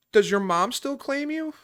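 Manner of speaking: high, sneering imitation of the mom; high mocking put-on of a rude lady; mock snooty question. Mom's rude question retold.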